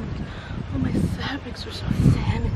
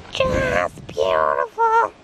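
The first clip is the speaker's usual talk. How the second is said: deep mock voice; slower than usual. Joke low voice.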